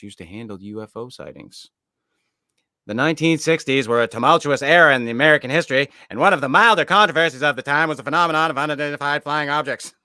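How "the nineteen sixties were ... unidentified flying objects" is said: put-on old newsreel announcer, fast and loud; 1940s narrator voice. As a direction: boom it out like a vintage newsreel. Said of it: "Why are you using a nineteen forties voice".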